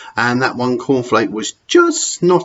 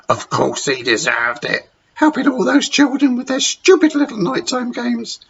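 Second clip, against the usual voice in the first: high, sneering matron outburst; thundering Matron; 14 semitones higher than usual. Acted. Matron defending the sacking, mock thundering. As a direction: spit it at the dinner lady. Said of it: "thundered Matron".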